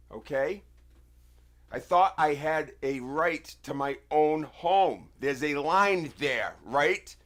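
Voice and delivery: real furious outburst. Real, not acted. Ranting at the officer about armed people on his porch, furious. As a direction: blow up at them, voice straining.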